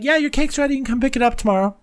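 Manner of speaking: high-pitched quoted shop-worker reply; bakery staff. Retold or imitated. Bakery staff answering the troll's call, high and hurried. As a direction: voice a quick, high phone answer.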